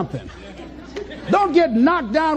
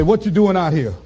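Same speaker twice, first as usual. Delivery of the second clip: lower, menacing taunt; life as a bully; 9 semitones lower than usual. Acted. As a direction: corner the dreamer like a bully.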